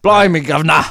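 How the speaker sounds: loud, high cartoon Cockney; mock British accent. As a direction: exclaim it in hammed-up Cockney British. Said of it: "have to do a British accent".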